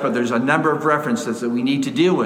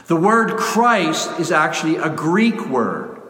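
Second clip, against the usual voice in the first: ordinary talk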